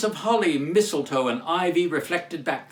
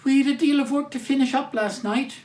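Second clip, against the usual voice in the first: put-on higher, girlish voice; young working girl; 10 semitones higher, 3 dB louder than usual. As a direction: explain like a tired young daughter. Martha replies.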